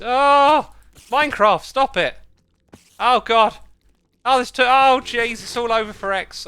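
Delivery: high frantic shouting; screaming at a spider. Real, not acted. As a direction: panic as spiders pile on. Spiders swarming him.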